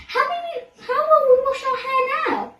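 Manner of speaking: very high, fast worried question; panicking about their hair; squeaky alarmed question; dismayed cry. A narrator's reading. Ellen dismayed.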